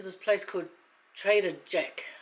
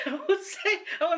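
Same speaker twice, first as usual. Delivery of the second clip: laughing; 10 semitones higher than usual. Real, not acted. Laughing while talking about cousin.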